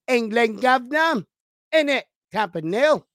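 hammed-up mock-London lilt; terrible London accent. Host greeting co-host about the London game, terrible London accent. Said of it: "That's a terrible London accent".

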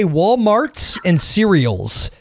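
"Walmarts" and "cereals" slowly, final S sounded; added final S. Retold or imitated. Quoting how they said store names, "Walmarts" with added S. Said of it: "end it with an S".

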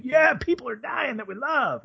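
very high, gleeful mock cheer; mock sadistic glee. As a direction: squeal it like a giddy sadist.

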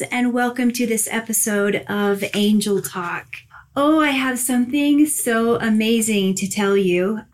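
usual speaking voice

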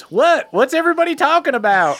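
high-pitched, quick call; high voice of an out-of-earshot neighbor; calling from upstairs. Acted. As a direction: yell down the stairs, confused. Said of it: "you hear from upstairs".

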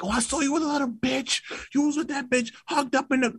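imitating a jealous girl; mock accusing girlfriend. Imitating a girlfriend accusing him, high and petty.

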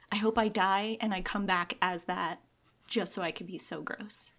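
normal talking voice

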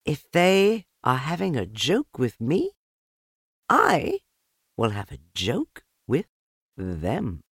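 high motherly voice; light, knowing mother kangaroo. A narrator's reading. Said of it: "So she said to herself".

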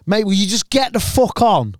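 put-on a light Irish accent, exasperated; mild Irish accent. Comic imitating a drunk Irish fan, lightly Irish. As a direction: toss it off in a hint of Irish. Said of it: "In his Irish accent".

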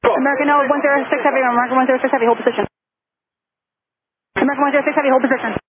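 urgent controller call on the radio. Real, not acted. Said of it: "hear the urgency in the air traffic controller's voice".

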